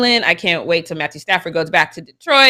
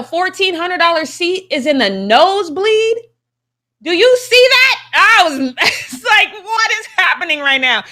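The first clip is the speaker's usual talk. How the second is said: can't believe the price; shouting and laughing in disbelief; outraged disbelief; 10 semitones higher than usual. Real, not acted.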